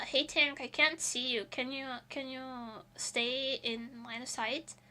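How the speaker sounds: soft, gentle call to the tank; a lightly voiced polite request; sweet polite teammate. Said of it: "I can just be like".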